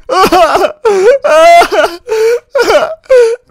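high, drawn-out wailing sobs; loud crying. Acted. Player crying over his blown-up house, loud wailing. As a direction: bawl in high, loud bursts. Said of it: "Niye ağlıyorsun?".

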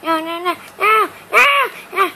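high, loud wailing over lost wood; mock panic over wood. Kid wailing at a game about his lost wood, loud and high. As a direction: cry out for the stolen wood, hammed up.

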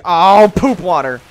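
loud, high dismayed cry; disgusted outburst. Real, not acted. Player landing in poop water, loudly disgusted. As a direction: burst out loudly, then ease slightly.

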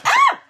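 high startled shriek; sudden scream. Real, not acted. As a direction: yelp out suddenly.

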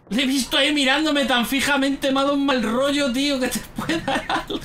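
high, hurried, breaking into laughs; shaken laughter. Real, not acted. Player retelling the scare, dissolving into laughter. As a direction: let nervous laughter take over the end.

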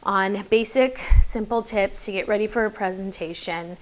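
mock reading off note cards, monotone; boring read-out. Shown as a demonstration. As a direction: drone through it like a dull script. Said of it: "boring. It's so boring".

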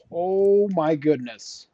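loud, drawn-out high exclamation; stunned relief. Real, not acted. Gamer after a narrow escape, stunned. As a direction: stretch it out, falling from high. Just survived a very close call.

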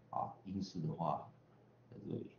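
ordinary talk

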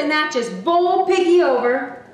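usual speaking voice

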